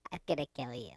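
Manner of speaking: soft, low menace; low mock threat. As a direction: threaten quietly, pitch dropped right down. Threat after being told not threatening.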